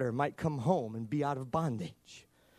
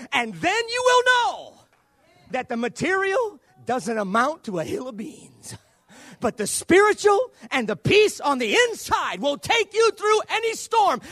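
high, shouted, driving preacher climax; preaching at full force; 12 dB louder than usual. Acted. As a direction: lift the whole room with every line.

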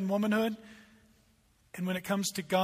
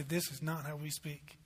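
low, soft, subdued reproof; tearful correction; 4 semitones lower, 11 dB quieter than usual. Acted. Father to his kids in the car, tearful and hushed. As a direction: gently correct them, voice dropped low. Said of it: "get a tear in your eye".

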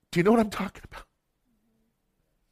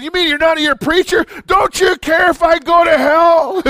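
put-on drunk man pleading; 7 semitones higher, 4 dB louder than usual. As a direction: beg like a drunk seeking help.

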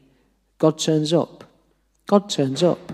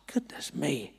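very soft, awed aside; retold thought, barely voiced; hushed inner thought; 3 semitones lower, 17 dB quieter than usual. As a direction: murmur it to yourself in mild shock. Retold shocked thought to himself.

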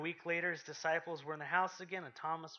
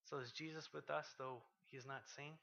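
much softer, fading intimate close; hushed reflection; 3 semitones lower, 10 dB quieter than usual. Acted. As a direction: draw the congregation in quietly.

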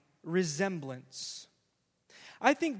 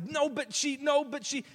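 put-on high, whiny child protest; mock childish "no, but she"; protesting kid; 7 semitones higher than usual. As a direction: squeak out a little sister's objection.

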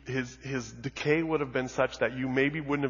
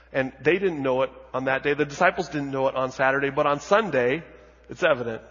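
louder, fervent preaching; 8 dB louder than usual. Acted. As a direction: drive the proclamation home. Preaching at full force.

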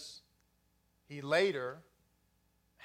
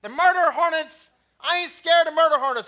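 bravado; 7 semitones higher, 9 dB louder than usual. Acted. Mock bravado about drill instructors.

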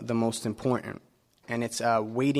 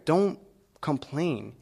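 usual speaking voice

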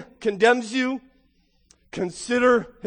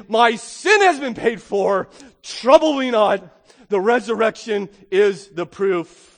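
loud, slow, defiant; bold declaration; 2 semitones higher, 7 dB louder, slower than usual. Pretend. Believer answering accusing sin, loud and defiant. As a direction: proclaim it like a victory. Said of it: "grab a hold of it and say".

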